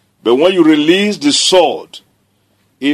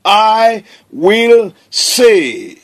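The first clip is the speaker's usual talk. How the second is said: drawn-out, every word weighted; slow emphatic declaration; 4 semitones higher, much slower than usual. Shown as a demonstration. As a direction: proclaim it slowly. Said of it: "That is how you enjoy".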